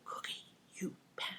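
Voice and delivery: hissed under the breath; whispered scold. Retold or imitated. Caregiver whispering a scold at the parrot. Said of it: "I go".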